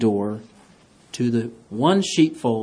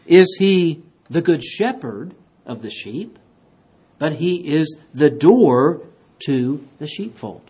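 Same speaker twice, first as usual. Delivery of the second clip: ordinary delivery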